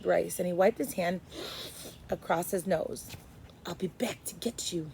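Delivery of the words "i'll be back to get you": quiet. A narrator's reading. Boy secretly promises Edward.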